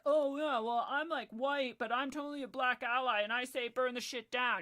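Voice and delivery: loud put-on eager ally; mocking imitation. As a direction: parody a show-off supporter.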